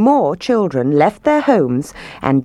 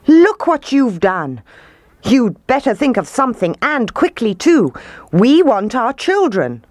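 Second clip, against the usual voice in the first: high-pitched scolding demand; angry cry; 7 semitones higher than usual. A narrator's reading. Townspeople demanding their children back from mayor, angry cry. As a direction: berate him, pitched well up. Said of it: "they cried".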